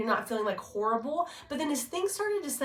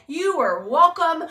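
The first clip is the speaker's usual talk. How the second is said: slow, louder mock grandeur; drawn-out mock welcome; playful sarcasm; 6 semitones higher, 8 dB louder, slower than usual.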